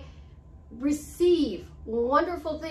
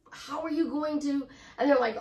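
ordinary talk, nothing marked

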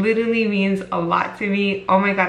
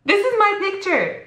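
much higher, thrilled and breathless; excited disbelief; 12 semitones higher than usual. Real, not acted. Vlogger showing her reposted photo, giddy and high.

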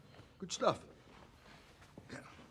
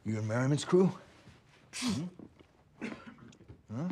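aggressive shouting; 7 semitones higher than usual. Acted. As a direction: hammer him with questions. Cop presses suspect hard.

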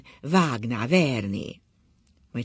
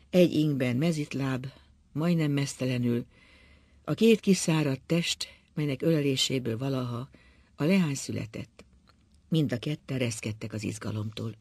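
ordinary delivery, nothing marked

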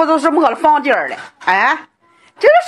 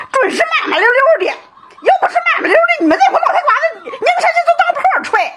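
high, crying complaint that breaks into a shout; high crying lament at the officials over the flood; wailing lament; 8 semitones higher than usual. Real, not acted. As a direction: sob out the grievance at the officials. Villager wails at officials over flood.

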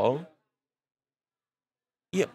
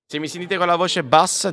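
a bit louder and higher, testing the mic; louder mic check; 6 semitones higher, 4 dB louder than usual. Shown as a demonstration. Streamer raising his voice to test the mic, louder. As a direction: push the voice up so chat hears. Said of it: "ditemelo che aumento il volume".

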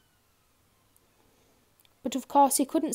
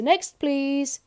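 loud, high call; brisk booming dismissal; thundering grocer; 6 semitones higher, 6 dB louder than usual. A narrator's reading. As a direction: shout for the next customer. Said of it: "thundered the grocer".

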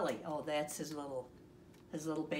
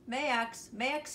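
higher, mild call at the door; shouting for a friend; 7 semitones higher, 4 dB louder than usual. A narrator's reading. Froggy at Max's door, mildly shouted call. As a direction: call out Max's name, pitched up. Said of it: "he shouted".

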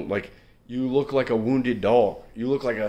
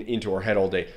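ordinary delivery

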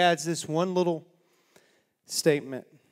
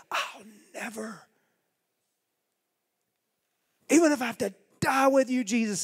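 earnest vow; 8 semitones higher, 5 dB louder than usual. Acted. Peter's earlier vow quoted.

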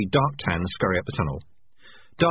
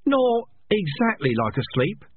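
slow, hesitant and higher; uncertain; 12 semitones higher, slower than usual. A narrator's reading. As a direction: let the doubt stretch each word. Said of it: "said a rat, uncertainly".

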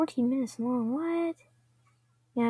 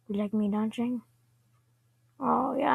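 ordinary talk, nothing marked

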